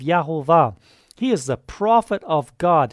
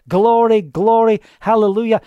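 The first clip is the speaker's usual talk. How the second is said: exultant praise; 4 semitones higher, 5 dB louder than usual. Real, not acted. Preacher exults.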